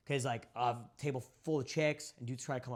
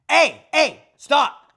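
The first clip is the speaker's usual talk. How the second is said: much higher, much louder command at a dog; commanding shout; 13 semitones higher, 23 dB louder, much slower than usual. Shown as a demonstration. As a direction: snap a dog out of it. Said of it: "through my tonality".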